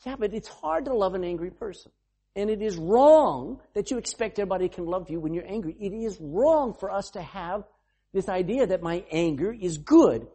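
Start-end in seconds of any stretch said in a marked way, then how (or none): none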